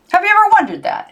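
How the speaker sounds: high and fast, eager; eager question. A narrator's reading. Uncle coaxing niece in car, high eager question. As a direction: press her keenly for an answer.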